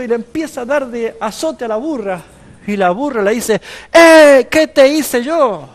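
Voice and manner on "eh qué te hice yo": high, loud, drawn-out protest from the beaten donkey; indignant donkey cry. Acted. The donkey protests loudly to the prophet who beats her. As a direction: cry out as the wronged donkey, slow and shrill with hurt.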